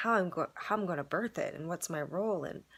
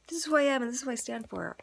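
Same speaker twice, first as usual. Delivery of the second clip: higher, quick proclamation of one's stand; soapbox declaration; 7 semitones higher, 4 dB louder, faster than usual. Retold or imitated. Storyteller voicing their own stand to the class, soapbox declaration. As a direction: declare it fast and high, as if on a soapbox. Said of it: "I'm kind of, like, on my, on a soapbox".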